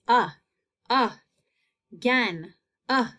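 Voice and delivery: uh as a short, separate sound; isolated short A. Shown as a demonstration. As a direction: pause between uh and the rest of again. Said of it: "I hear short A's".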